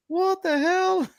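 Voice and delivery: high, drawn-out disbelieving "what the hell"; incredulous outcry. Retold or imitated. Fan recalls reacting to Ollie Watkins' penalty miss, high incredulous outcry.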